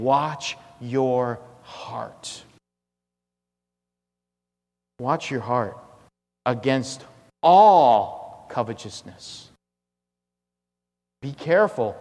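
very slow, one word at a time; solemn warning. Acted. Preacher voicing Jesus warning disciples about covetousness, slow solemn warning. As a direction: drag out each word gravely, with weight on ALL.

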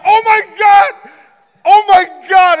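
loud high panic, half laughing; panicked shout. Retold or imitated. Pastor realizing John has asthma, loud high panic. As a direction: yell it in sudden alarm. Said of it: "And I'm like".

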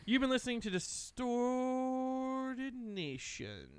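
slow, drawn-out station ID; radio announcer voice. Acted. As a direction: stretch it like a DJ sign-off.

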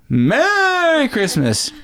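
high, very slow, drawn-out Santa Merry Christmas; jolly Santa voice. Retold or imitated. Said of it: "say it like Santa Claus".

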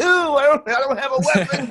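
high, loud panicky squeak with a laugh; panicked realization. Retold or imitated. Realizing mid-confrontation he has no weapon, a high panicked squeak.

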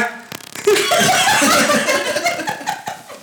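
high squealing laugh of delight; shrieking laugh. Pretend. Girlfriend greeting boyfriend at the door with a high shriek. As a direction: let out a shrill girly shriek.

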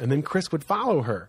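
usual speaking voice

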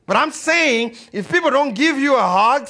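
loud, high preacher's cry; shouted sermon climax; preaching at full force. Acted. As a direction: proclaim it to the whole room. Preaching peak to congregation.

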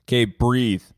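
loud, abrupt instruction to breathe; mock meditation coach. Imagined alternative to soothing yogis, loud blunt breathing command. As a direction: give the order loudly, like a blunt coach. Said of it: "someone who was like".

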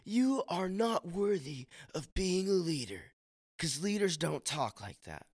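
high, hammed-up telling-off; rash rebuke, acted. Preacher showing how not to correct a leader, mock rebuke. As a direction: imitate someone harshly dressing down a leader. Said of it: "rash thinking or, or just saying".

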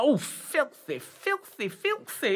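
gleeful panto dame; relishing. Acted. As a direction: savour each filthy. Dame relishing dirty washing.

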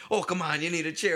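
high, loud mock seller; pushy chair seller. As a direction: push the chair on him. Chair guy voiced.

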